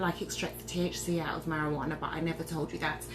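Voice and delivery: drops to a low, soft conspiratorial aside; hushed aside. Real, not acted. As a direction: let it slip out quietly, like a secret.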